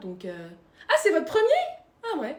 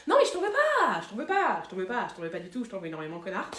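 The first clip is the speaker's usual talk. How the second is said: passive-aggressive forgiveness; 4 semitones lower than usual. Acted.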